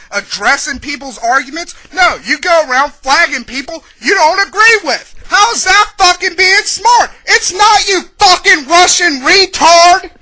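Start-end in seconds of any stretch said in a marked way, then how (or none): 1.9-10.1 angry shouting. Real, not acted. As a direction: rant building to a loud, high yell. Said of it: "this is gonna be a fucking angry".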